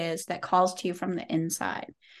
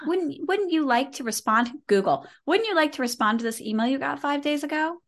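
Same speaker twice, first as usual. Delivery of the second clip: higher-pitched, cloying reminder voice; nagging email prompt; 6 semitones higher than usual. Acted. App nudging someone about an old email, mock-polite nagging. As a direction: mimic a pestering app's polite nudge.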